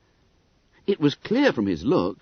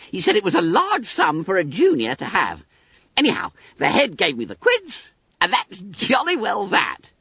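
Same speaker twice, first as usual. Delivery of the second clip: high, squeaky schoolboy voice; self-satisfied Billy Bunter; 10 semitones higher, 4 dB louder than usual. A narrator's reading. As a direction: pipe it up high, full of importance.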